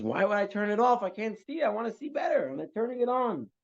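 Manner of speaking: high-pitched, protesting comeback; mock-indignant villager holding his ground; indignant retort. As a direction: argue back at the crowd in a high voice. Acted retort to shouting audience.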